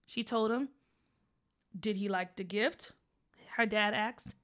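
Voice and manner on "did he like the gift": dad voice. Acted. Dad low voice.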